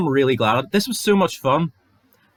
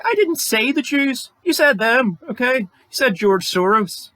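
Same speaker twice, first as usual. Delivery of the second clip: mocking whiny voice; 13 semitones higher than usual. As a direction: whine it like a caught-out online troll. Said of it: "And I know you'll say".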